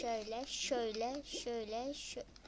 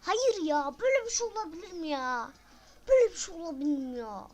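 outraged disbelief; 4 semitones higher, 8 dB louder than usual. Real, not acted. Disbelief at game.

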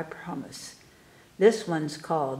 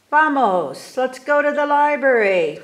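loud, bright call to come along; eager call; 6 semitones higher, 8 dB louder than usual. Acted. Tomas calling his family to the library, loud eager call.

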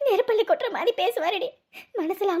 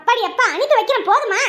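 loud, exasperated retort; loud snap; 3 semitones higher, 7 dB louder than usual. Acted. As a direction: shut him down loudly. Wife snapping back.

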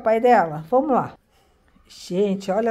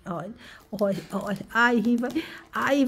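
usual speaking voice

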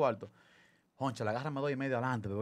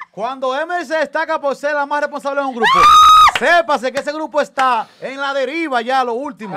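exclamación burlona; 5 semitones higher, 9 dB louder than usual. Real, not acted. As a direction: announce it like breaking news, teasing. Burla exclamando sobre Emily. (proclamación burlona en voz alta)

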